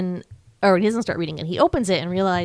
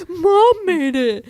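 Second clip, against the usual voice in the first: mock sad kid; 10 semitones higher, 5 dB louder, slower than usual. Bastian answering his dad.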